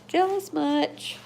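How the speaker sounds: high, slow, loud teasing. Real, not acted. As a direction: pitch the tease way up.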